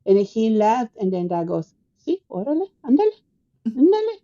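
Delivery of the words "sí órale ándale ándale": high-pitched imitation of Dad egging her on; goading dad. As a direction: needle her in short, rising prods. Dad gleefully goads her to go.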